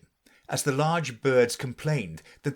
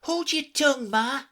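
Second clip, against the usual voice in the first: put-on much higher young crab, mildly snappy; a little snappish. A narrator's reading. Young crab snapping at her mother, mild. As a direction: snap back at mother. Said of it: "a little snappishly".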